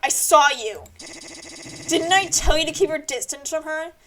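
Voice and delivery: ordinary talk, nothing marked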